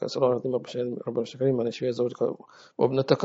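rapid, hushed prayer repeated over and over; murmured prayer. Acted. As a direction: murmur the supplication quickly under the breath. Repeated healing supplication. (دعاء سريع بصوت خافت)

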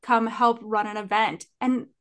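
ordinary talk, nothing marked

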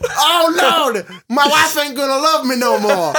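hammed-up high wail, cry in the voice; distressed wail. Injured man lamenting to the Lord, mock crying wail.

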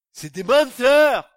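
loud, slow outburst; furious denunciation. Real, not acted. Preacher denouncing politicians to the church, loud slow outburst. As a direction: thunder it at the congregation.

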